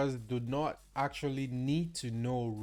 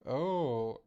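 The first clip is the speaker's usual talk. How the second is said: normal talking voice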